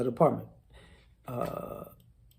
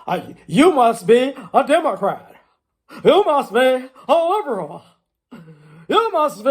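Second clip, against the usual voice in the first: mock accuser; 4 semitones higher, 6 dB louder than usual. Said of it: "let me use a blanket statement".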